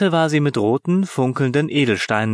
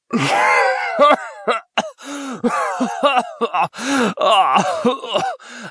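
croaky cough; 12 semitones higher than usual. A narrator's reading. Genie coughing as he emerges from smoke. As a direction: cough hard through the smoke. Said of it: "Ein krächzendes Husten".

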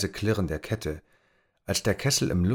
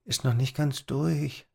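put-on slow, higher musing boy; Tom murmuring; 6 semitones higher than usual. A narrator's reading. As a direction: mumble it to yourself. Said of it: "murmelte er". (leise murmelnd)

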